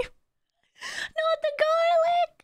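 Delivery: high, drawn-out whimpering protest; mock wail. Streamer dreading the stupid garlic video, mock wailing. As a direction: wail in pretend horror, slowly.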